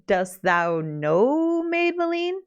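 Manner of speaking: very slow, drawn-out questioning; slow suspicious question. Acted. Prince probing the bride about Maid Maleen, very slow. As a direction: stretch every word as he probes her.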